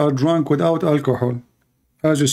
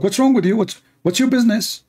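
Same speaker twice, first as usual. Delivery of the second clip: higher-pitched indignant retort; imitated anger; 6 semitones higher than usual. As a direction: mimic a man annoyed at being interrupted. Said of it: "He got angry from me".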